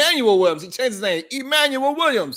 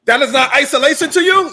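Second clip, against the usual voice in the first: louder, pushing back in disbelief; incredulous outburst; 4 semitones higher, 6 dB louder than usual. Real, not acted. Arguing with S1 about isolation, loud and incredulous. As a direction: call the claim absurd, voice raised.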